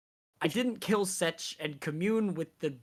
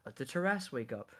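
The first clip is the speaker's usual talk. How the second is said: ordinary delivery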